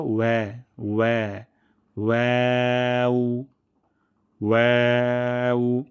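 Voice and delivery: ওয়া as "we", building to long ওয়াও "weu"; correct waw. Shown as a demonstration. Teacher modelling correct form, drawn thin "weu" ওয়াও. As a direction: spread lips into a thin ae in ওয়াও. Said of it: "আর সঠিকটি হলো".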